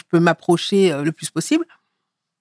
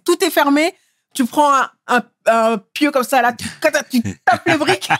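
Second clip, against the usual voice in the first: animated build-up; 8 semitones higher than usual. Real, not acted. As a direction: pitch climbs higher as the image escalates. Escalating break-in metaphor, animated. (de plus en plus animée, voix qui monte)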